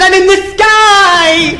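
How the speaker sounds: very high, stretched-out comic panic; panicked wail. Acted. Lost novice flyer answering the controller, panicked.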